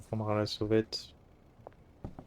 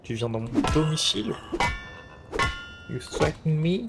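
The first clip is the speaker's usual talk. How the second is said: higher, louder mock threat; mock tough guy; 6 semitones higher, 3 dB louder than usual. Mock confronting game intruder.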